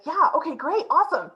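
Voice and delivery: playing the manager perking up keenly; eager yes. Manager perking up at a question, mock eager. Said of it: "he kind of sits up and he's like".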